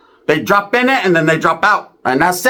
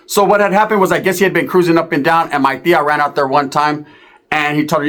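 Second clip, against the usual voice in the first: normal talking voice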